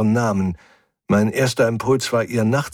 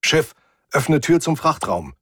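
higher-pitched order to the ship; firm command; 8 semitones higher than usual. A narrator's reading. Narrator orders the ship about. (Befehl ans Schiff, höhere Stimme)

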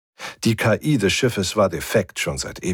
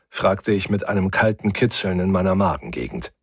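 plain reading voice

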